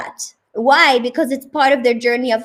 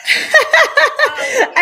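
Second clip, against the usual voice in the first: bright laugh. Real, not acted. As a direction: burst of laughter. Host laughs at guest's reaction.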